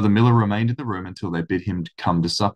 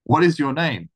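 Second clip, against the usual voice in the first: gruff demand; 6 semitones higher than usual. A narrator's reading. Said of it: "with another oath".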